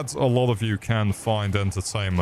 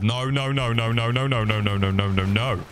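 rapid panicked no-no-no; 2 semitones higher than usual. Real, not acted. Gamer reacting to sudden trouble mid-game, rapid panicked noes. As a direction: rattle off noes in one unbroken run.

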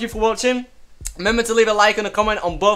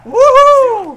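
loud, high-pitched cheer; triumphant whoop; 10 dB louder than usual. Real, not acted. Player celebrating the thousand kills, triumphant whoop. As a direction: whoop out the win, drawn out.

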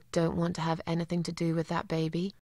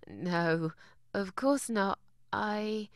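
soft, awkward little laugh, then halting denial; flustered denial; 6 dB quieter, slower than usual. Acted. Visitor asked if she wants the job too, flustered and quiet. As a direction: deny it quietly and trail off.

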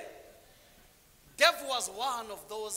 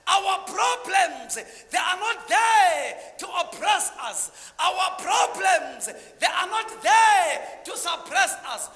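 loud, high and slow fervent sermon; thundering preacher; slow, booming preaching; 7 semitones higher, 10 dB louder, slower than usual. Real, not acted. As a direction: hammer each line to the crowd. Preaching at full force.

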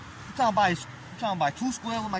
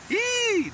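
high squeal. Acted. Squeal after laugh.

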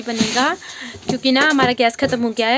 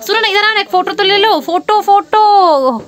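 high, loud call across the room; 9 semitones higher, 8 dB louder than usual. Real, not acted. Calling her little brother over for a photo, loud and high. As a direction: call him over, pleading.

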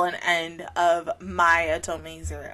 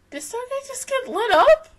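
shocked. Real, not acted. Shocked reaction to show.

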